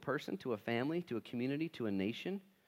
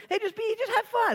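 much higher, sing-song plea; mock cheerful plea; 7 dB louder than usual. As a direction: squeak out a naive plea for peace.

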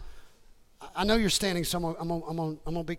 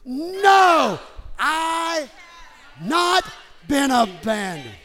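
loud, high shouted declaration; ticked-off authority; 5 semitones higher, 6 dB louder, much slower than usual. Shown as a demonstration. Preacher leading church against depression, powerful angry shout. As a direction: shout each piece for the crowd to repeat. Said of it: "speaking it with authority and power".

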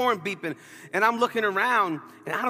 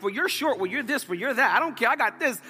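pitched-up playful back-and-forth; retold joking banter; 4 semitones higher than usual. Pastor and new acquaintances making fun of each other, playful banter. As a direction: toss mock insults lightly to and fro. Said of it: "We just cracked jokes".

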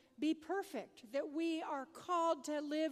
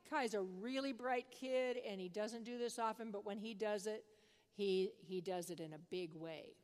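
usual speaking voice

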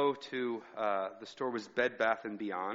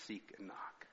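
whispered; 4 semitones lower, 16 dB quieter than usual. Real, not acted. Quiet close to a verse.

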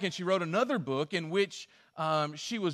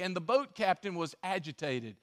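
usual speaking voice

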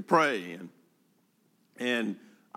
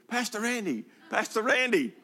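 put-on much higher little boy's voice, calling out; calling child; 11 semitones higher than usual. Pastor imitating a neighborhood boy calling his name, high child voice. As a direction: call after the pastor like an eager eight-year-old.

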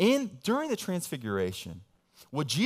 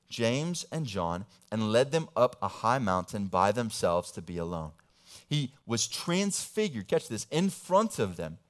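measured Bible reading, building in energy; lightly voiced scripture read; 5 dB louder than usual. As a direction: read the passage with rising drama. Reading scripture aloud in sermon.

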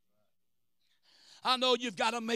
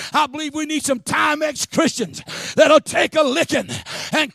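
much louder and higher, shouted sermon peak; climactic preaching; 4 semitones higher, 15 dB louder than usual. Acted. Preacher urging the church to get up and praise, shouted preaching. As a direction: whip the room up to praise.

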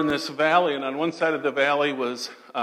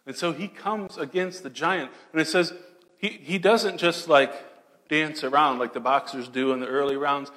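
normal talking voice

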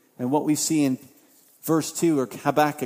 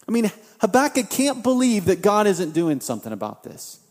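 normal talking voice

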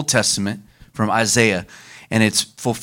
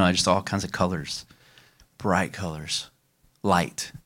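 softer, lower, awed recollection; hushed wonder; 5 semitones lower, 9 dB quieter than usual. Real, not acted. Woman recalling her vision to the group, hushed and awed. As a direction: let it fall away to a near hush. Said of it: "describes vision softly".

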